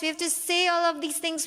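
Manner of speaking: ordinary talk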